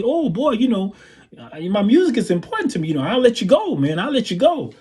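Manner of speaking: high, swooping mock threat. Singer threatening to fire the drummer, imitated high scolding. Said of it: "So he looks at me".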